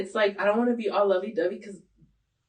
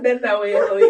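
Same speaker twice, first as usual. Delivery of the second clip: calling her name out loud in mock protest; a loud playful name call at a teasing friend; 9 dB louder than usual. Real, not acted. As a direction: call her name loud, half-laughing, like you're caught out.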